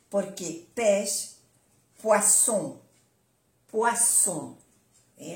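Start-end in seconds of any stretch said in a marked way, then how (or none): 2.0-4.6 "poisson" with an unvoiced s; double S. Shown as a demonstration. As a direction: say "poisson" with a clear hissed s. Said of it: "Las dos S".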